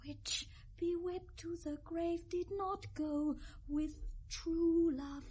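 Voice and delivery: whispered line of the song. Acted. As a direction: breathe it as a secret. Mad song drops to whisper.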